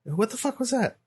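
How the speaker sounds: hushed-level, high-pitched disbelief; acted-out bewilderment. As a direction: act out a stunned parent. Retelling parents' bewilderment at night.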